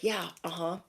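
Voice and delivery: soft, absent-minded listening noises; half-listening murmurs of fake attention; inattentive therapist. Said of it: "being at a therapist and they're like".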